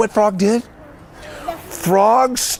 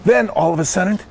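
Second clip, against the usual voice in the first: usual speaking voice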